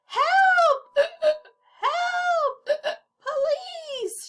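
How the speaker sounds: high panicked shouting; frantic high cries. Acted. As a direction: shout for help, gasping. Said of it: "shouted Mister Penny".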